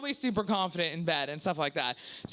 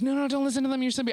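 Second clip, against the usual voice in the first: higher-pitched imitation of a consoling man; urgent consoling; 6 semitones higher, 4 dB louder, faster than usual. Man soothing his crying date, urgent reassurance. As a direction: hurry to comfort someone upset. Man comforting her as she cries.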